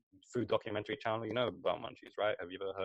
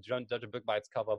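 usual speaking voice

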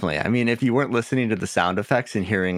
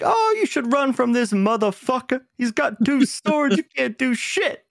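high-pitched mock screaming; Ninja Ninja; 14 semitones higher than usual. Fan mimicking the sidekick heckling during a fight, high mock scream. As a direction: squeal it out in a hammed-up character voice. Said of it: "he's, like, screaming, like".

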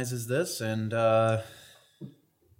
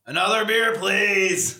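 loud, high bar order; calling out; 8 semitones higher, 4 dB louder, slower than usual. Acted. Drinker calling to the bartender for beer, high and loud. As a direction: sing out for a drink across the bar.

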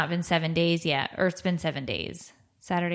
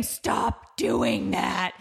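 long, drawn-out mock scream; slow, hammed-up scream without full volume; retold scream; 2 semitones higher, much slower than usual. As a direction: stretch each word into a strained mock yell. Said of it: "so I just scream".

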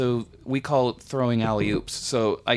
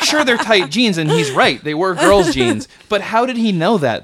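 ordinary talk, nothing marked

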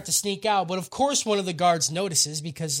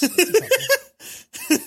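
higher, slow, laughing through it; 12 semitones higher, 5 dB louder, much slower than usual. Real, not acted. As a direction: giggle out the words at yourself. Laughing at his own few states.

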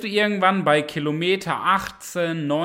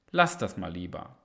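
discouraging inner voice; 8 semitones lower, 6 dB quieter than usual. Retold or imitated. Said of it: "diese kleine Stimme in deinem Kopf sagt dir".

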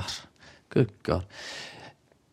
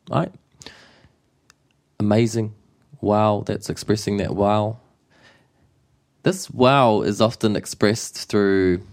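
ordinary talk, nothing marked

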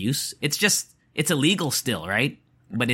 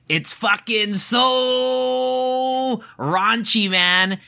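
high-pitched, hugely drawn-out "so"; scandalised exclamation; 10 semitones higher, much slower than usual. Real, not acted. Host telling listeners about the shocking text messages, scandalised exclamation. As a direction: stretch "so" out high in disbelief.

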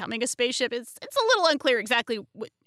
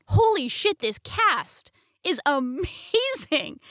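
excited exclamation; 5 semitones higher, 3 dB louder, much slower than usual. Retold or imitated. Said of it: "is like".

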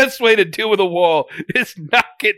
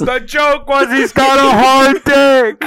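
loud; 6 semitones higher, 9 dB louder, slower than usual. Real, not acted. Shouting the joke.

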